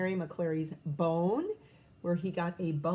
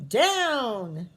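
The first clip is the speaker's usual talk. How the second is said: called out; 6 dB louder than usual. Acted. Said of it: "called the leader".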